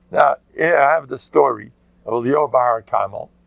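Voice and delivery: ordinary talk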